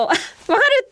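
high-pitched burst of recognition; eager sympathy. Retold or imitated. Seeing a colleague get corrected, high excited empathy. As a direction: exclaim it in giggly fellow-feeling.

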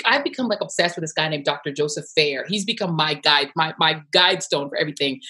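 ordinary talk

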